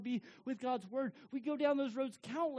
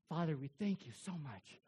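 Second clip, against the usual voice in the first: lower, hushed prayer voice; 9 semitones lower, 3 dB quieter than usual. Acted. Pastor praying for the congregation, reverent and hushed.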